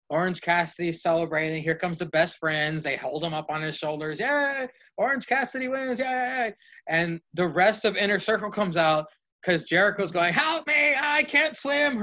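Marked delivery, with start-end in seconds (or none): 4.2-6.5 high, cheesy cheering of the best friends; playful high-pitched celebration; mock cheering. Best friends celebrating in imagined match. 10.3-12.0 high, slow cry for help; hammed-up drowning plea; cartoonish high yelp for rescue; mock panic. Jericho drowning in the mimosa tank.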